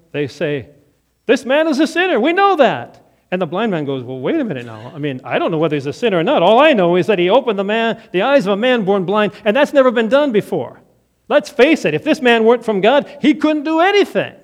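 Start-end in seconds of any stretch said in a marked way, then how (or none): 1.2-3.0 high, loud accusation; accusing leaders. Acted. Religious leaders condemn. 4.0-14.3 fast, rising to louder; blind man insisting. Acted. Blind man defends Jesus.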